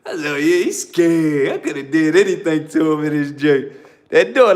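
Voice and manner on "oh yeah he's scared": slow, drawn-out knowing remark; sly realization. Retold or imitated. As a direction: say it slowly, sizing him up. Sly thought, knife in hand.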